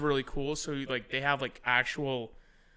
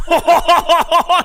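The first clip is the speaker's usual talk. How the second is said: very loud, high belly laugh; booming re-enacted laughter; imitated hard laugh; 19 dB louder than usual. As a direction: burst out in an imitated guffaw. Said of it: "he starts laughing so hard".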